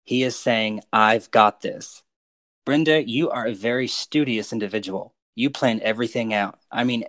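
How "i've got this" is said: slow, louder, every word weighted; all caps emphasis. Shown as a demonstration. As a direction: lean hard on I've got this. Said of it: "he put this next phrase in caps".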